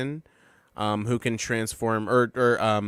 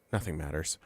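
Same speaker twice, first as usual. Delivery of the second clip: nihilist murmur; 8 semitones lower, 11 dB quieter than usual. Retold or imitated.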